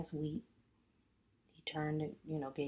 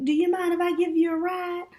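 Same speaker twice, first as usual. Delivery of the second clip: bright, pitched-up offer of a ride; high young woman voice; 10 dB louder than usual. Retold or imitated. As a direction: pitch up and louder as the lady. Young lady's voice in retold story.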